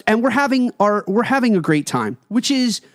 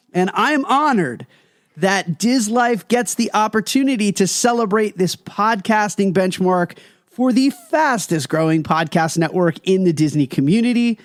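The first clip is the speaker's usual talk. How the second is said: ordinary delivery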